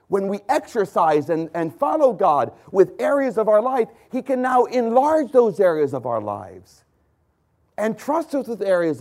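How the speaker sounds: fervent sermon build; preaching. Acted. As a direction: drive it home from the pulpit. Preacher building to full force.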